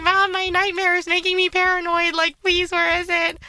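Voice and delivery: high-pitched, mildly whiny plea; retold pleading. Daughter retelling her plea to mom, pleading.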